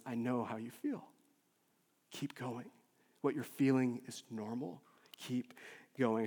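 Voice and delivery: very soft, low encouragement; hushed, gentle reassurance; comforting friend. Acted. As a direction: murmur it like an arm round the shoulder. Voices friend's arm-round comfort.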